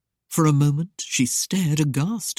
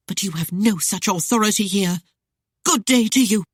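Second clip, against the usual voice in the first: raised, dismissive farewell; hurled answer; 4 semitones higher, 4 dB louder than usual. A narrator's reading. Lady dismissing the captain, raised and curt. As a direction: cut him off with a curt good day. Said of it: "Arienne hurled back her answer".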